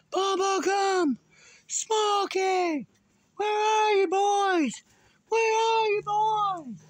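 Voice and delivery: high, drawn-out calling yell; screaming for dogs. Retold or imitated. Owner searching for two lost dogs, screaming their names. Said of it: "I was screaming".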